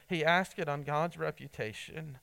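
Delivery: ordinary talk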